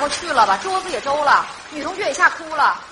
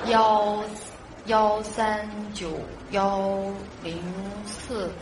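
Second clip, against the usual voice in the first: low, slow digit-by-digit muttering; drawn-out, low-pitched digits; dialling aloud; 9 semitones lower, 7 dB quieter, much slower than usual. Acted. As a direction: read the number out slowly as you dial. Reading number aloud while dialling.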